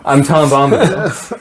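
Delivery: slow, laughing self-introduction. Retold or imitated. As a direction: grin through the name. Laughing as Tom.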